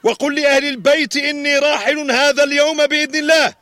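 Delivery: loud commanding captain; booming historical epic. Acted. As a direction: bark the orders like a sea captain. Captain orders his son. (صوت ربان آمر مرتفع)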